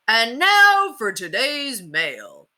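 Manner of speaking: put-on hammy children's TV sheriff, slow and booming; TV cowboy host. A narrator's reading. Sheriff Bud to kids in TV land, hammy host voice. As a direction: sell it to the kids like a TV huckster.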